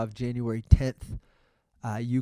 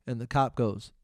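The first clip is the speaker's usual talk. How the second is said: ordinary delivery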